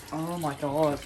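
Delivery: disgusted at the stink; smelling something awful. Real, not acted. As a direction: speak while smelling something foul. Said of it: "that stinks".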